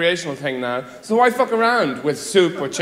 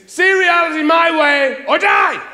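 high, slow, loud demand; mock tyrant roar; mock shout; 9 semitones higher, 4 dB louder, slower than usual. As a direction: bellow it like a tyrant. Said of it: "what that means is".

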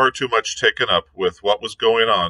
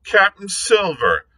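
high, mocking disbelief; scornful; 8 semitones higher than usual. A narrator's reading. The captain scorns the pirate's new rank, high and mocking. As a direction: sneer at the self-made title. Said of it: "said the captain".

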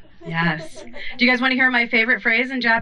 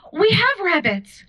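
high, shocked cry; horrified; 8 semitones higher than usual. Retold or imitated. Telling Charlotte about their pet bunnies, shocked and high. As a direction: gasp out the realisation.

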